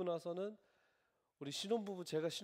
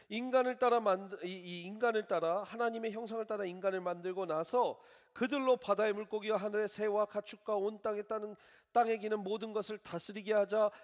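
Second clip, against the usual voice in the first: fervent scripture reading; 6 semitones higher, 7 dB louder than usual. Acted. Pastor proclaiming Genesis to his congregation, fervent preaching. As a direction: read Genesis with full preaching force.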